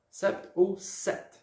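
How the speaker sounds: sept with P, then "sete" without it; two pronunciations contrasted. Shown as a demonstration. Teacher recapping both ways for pupils, slow contrast. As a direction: slowly set sept with sounded P against plain "set". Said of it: "pronunciando aqui o".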